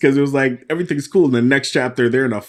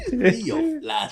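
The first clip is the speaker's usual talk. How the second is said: slow, higher, giggling through the threat; laughing mock threat; 12 semitones higher, 4 dB quieter, slower than usual. Friend finishing a villain's line, laughing at it. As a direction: threaten while cracking up. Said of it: "his shit talk".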